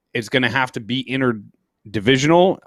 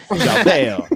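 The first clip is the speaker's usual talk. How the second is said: Chowder in a put-on mock accent; 2 semitones higher than usual. Shown as a demonstration. Host demos the word Chowder in his silly voice, mock accent. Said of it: "when I do that voice".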